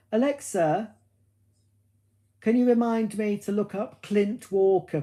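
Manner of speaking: high, carrying, measured command voice; voice-assistant command. Real, not acted. Speaker asks Alexa to set a reminder, voice-assistant command. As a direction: project it clearly for the smart speaker.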